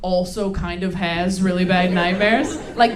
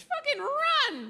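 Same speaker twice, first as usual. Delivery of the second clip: mock panic; 3 dB quieter, much slower than usual. Warning women about guys.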